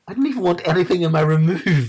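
high, loud indignant cry; high, loud, exasperated shout at mum; exasperated outburst. Retold or imitated. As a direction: let her have it, fed up. Furious reply to mum.

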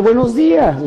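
high-pitched mock yell; shouted greeting. Man greeting a packed elevator by surprise, shouting. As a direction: burst out with a hammed-up, high greeting. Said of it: "un güey que entra gritando".

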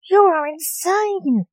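high, drawn-out indignant shout; shouting back. A narrator's reading. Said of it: "shouted back Mary".

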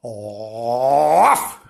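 low, drawn-out woof; long rising dog woof; dog bark. A narrator's reading. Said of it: "he barked".

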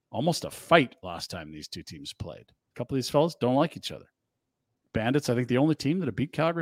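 ordinary talk, nothing marked

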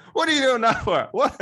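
high, loud mock mum yelling across the till; mum's outburst. Mum at the till yelling at her daughter, high mock shriek. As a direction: shriek it like an outraged mum at the checkout. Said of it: "And she goes".

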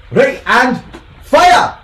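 slow, loud, high call; commanding shout. Acted. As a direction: bark the range order. GM calling out range command.

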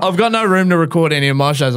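high, fast, exasperated mum; a mother fed up with cricket filling the recorder, high and rushed; mum's complaint. Retold or imitated. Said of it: "She's like".

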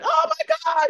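very high, loud mock wail; imitated tearful screaming. Wife imitating her husband at the nomination, high screaming cry. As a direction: squeal it like an overwhelmed, crying husband. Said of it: "he started screaming and crying too".

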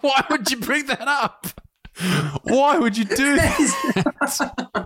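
high, slow squeal of disbelief, laughing; cracking up while asking why. Retold or imitated. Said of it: "And I was like".

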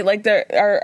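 ordinary talk

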